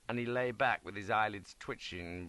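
ordinary delivery

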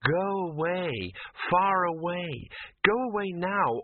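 slow, sweet, dreamy warning; a lightly voiced entranced woman, sweetly; sweet but otherworldly dismissal. A narrator's reading. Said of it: "she said sweetly".

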